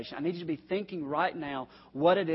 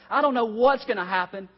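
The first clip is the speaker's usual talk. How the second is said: louder and higher, anguished cry of crisis; 7 semitones higher, 7 dB louder than usual. Retold or imitated. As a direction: call it out like a man at the end of his rope. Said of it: "We go from this crisis".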